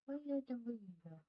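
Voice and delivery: quick sing-song question to a pet; pet baby talk. Acted. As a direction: rattle it off playfully at the cat. Repeats to the cat, faster, higher.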